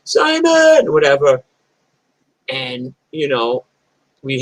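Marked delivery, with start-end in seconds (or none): 0.0-0.9 loud, high-pitched holler; yelled name. Retold or imitated. Visitor at a friend's house, loud high yell. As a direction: call out for a friend from the street. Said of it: "you yelled".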